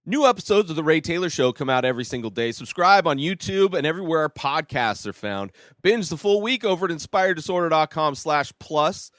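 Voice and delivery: upbeat outro promo read. Acted. Host plugging his show to listeners, fast promo read. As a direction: rattle through the plugs like a radio promo.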